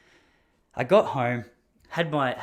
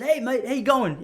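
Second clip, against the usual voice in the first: cheery greeting; 10 semitones higher, 5 dB louder than usual. Retold or imitated.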